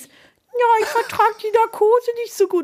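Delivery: high squeaky put-on dog voice. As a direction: speak as the little dog in a silly high voice. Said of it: "auch mit einer verstellten Stimme".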